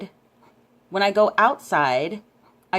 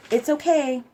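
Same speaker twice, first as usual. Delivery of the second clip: called out; 7 semitones higher than usual. Retold or imitated. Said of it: "he's kinda yelling out to me".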